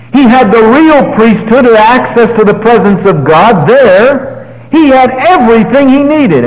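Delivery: high, loud, rising pulpit fervour; preaching at full force. Acted. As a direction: build to a fervent pulpit climax. Preaching climax.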